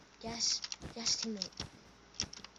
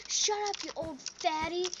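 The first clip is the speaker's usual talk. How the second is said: high, bratty retort; sassy comeback; 6 semitones higher than usual. Retold or imitated. Kid talking back to the drunk guy, high bratty. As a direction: fire it back like a cheeky kid. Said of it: "And I said".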